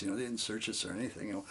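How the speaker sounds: ordinary talk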